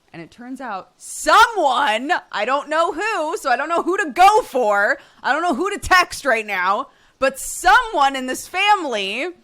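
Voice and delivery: loud, exasperated venting; indignant rant. Real, not acted. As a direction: call out the culprit, fed up and loud. Ranting about a relative's fairy story.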